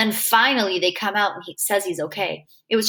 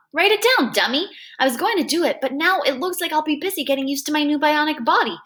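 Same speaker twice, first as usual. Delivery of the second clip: bossy; 6 semitones higher than usual. Acted. Freak ordering Max, high.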